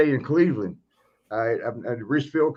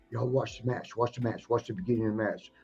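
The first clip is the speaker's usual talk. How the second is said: much softer, conspiratorial aside; lightly voiced, tip them off quietly; hushed tip-off; 3 semitones lower, 8 dB quieter than usual. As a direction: veteran quietly telling buddies to watch. Slaughter tipping off his friends.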